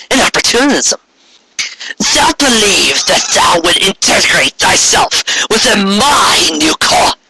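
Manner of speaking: high, slow mocking judgment; scornful accusation. Corona exposing Trixie, slow and high. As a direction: lay bare her cowardice with relish.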